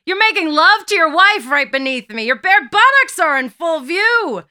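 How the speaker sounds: high-pitched, loud accusation; scandalized shout. A narrator's reading. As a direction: shout down, appalled and indignant. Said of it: "shouts Peter".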